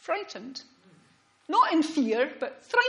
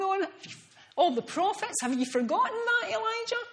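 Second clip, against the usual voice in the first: raised pitch, searching and insistent; God gently rebuking a frightened prophet, voice pitched up; God's voice questioning Elijah; 6 semitones higher than usual. Acted. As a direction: lift the voice high, pressing Elijah with each question. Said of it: "he was asking Elijah".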